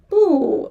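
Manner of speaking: 不 with a strong falling tone; 不 said bù, sharp fall. Shown as a demonstration. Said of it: "original is falling tone".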